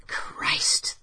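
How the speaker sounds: breathless whisper of alarm; frightened whisper. Acted. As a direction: whisper it, frozen in the dark. Terrified thought in the dark.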